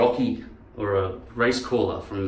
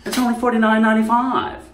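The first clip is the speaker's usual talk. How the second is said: high, loud whining woman; Jane Fonda; 10 semitones higher, 5 dB louder than usual. Acted. Speaker mimics actress who always whines, high whine. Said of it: "She always whines like this".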